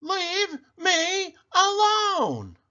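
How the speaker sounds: put-on old woman shouting back at her family as she leaves, high and drawn out; shouted. A narrator's reading. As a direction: draw it out long and high like a fed-up old woman. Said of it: "she shouted back".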